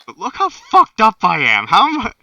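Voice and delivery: high, loud worked-up rant; agitated. Real, not acted. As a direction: voice jumps up, upset and loud. Wounded player rants about injuries.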